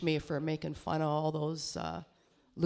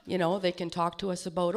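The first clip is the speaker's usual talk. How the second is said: normal talking voice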